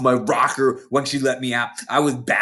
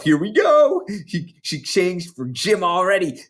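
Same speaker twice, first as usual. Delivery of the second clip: gleeful aside; 7 semitones higher than usual. Retold or imitated. Gleeful girl aside to herself.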